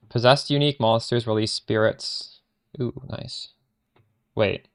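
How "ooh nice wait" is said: normal talking voice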